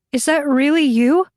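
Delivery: high, urgent question rising at the end; disbelieving plea. A narrator's reading. Tortured captive asks her rescuer if it's really her, high and pleading.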